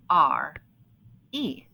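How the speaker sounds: normal talking voice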